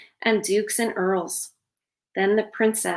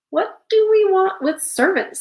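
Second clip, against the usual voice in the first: scoffing retort; 10 semitones higher, 6 dB louder than usual. Acted. Musician scoffs.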